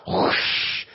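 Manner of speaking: breathy whoosh of speed; whooshing sound. Acted. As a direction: rush of air like a speeding truck. Truck speeds off.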